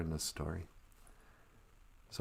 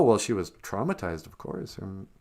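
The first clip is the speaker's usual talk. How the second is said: usual speaking voice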